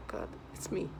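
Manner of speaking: hammed-up gasp, then whispered horror; mock gasp. Mocking the reaction they expect from him. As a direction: fake a startled whisper. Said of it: "I'm supposed to be like".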